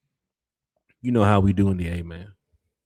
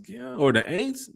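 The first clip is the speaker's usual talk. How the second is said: mocking Aints; 10 semitones higher, slower than usual. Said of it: "Carrie said".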